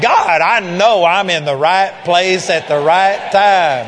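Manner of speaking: loud, slow and overjoyed, calling out to God; joyful excitement. Retold or imitated. Preacher recalling his joy after leaving the car, loud and slow. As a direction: let the joy burst out slowly and loudly. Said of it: "you talk about joy and excitement".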